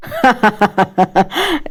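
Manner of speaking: short soft laugh; light laugh. A narrator's reading. Husband laughs at wife's proverb, softly. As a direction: laugh quietly at the joke.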